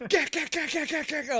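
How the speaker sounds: high, loud repeated yelp; frantic urging. Retold or imitated. Urging his friend on, high and loud. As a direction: yelp it rapid-fire like a panicked passenger.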